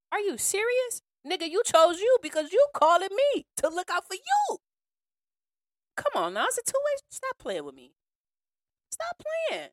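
high, loud, exasperated disbelief; you can't be serious, said high and loud; incredulous outburst. Real, not acted. Scolding the selfish caller in high, loud disbelief.

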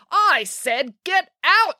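high, slow, emphatic yell; furious old lady ordering out. A narrator's reading. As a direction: yell it word by word in anger. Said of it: "yelled the cat lady".

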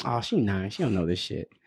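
low, soft aside under his breath; a quiet reminder to himself; dropped-down private thought; muttered to himself. Retold or imitated. His own inner thought, quoted.

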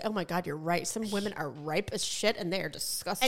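low, soft aside; mock confiding. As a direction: imitate a hushed, deadpan admission. Imagined candid agreement from doctor.

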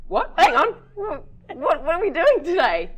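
high, loud acted confusion; baffled retelling. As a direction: act out being caught off guard. Acts out own confused reaction.

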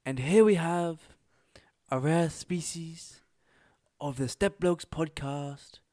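slow, breathy wildlife narrator, half whispered; David Attenborough impression. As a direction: hush it like a nature documentary. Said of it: "David Attenborough impression".

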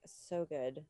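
ordinary talk, nothing marked

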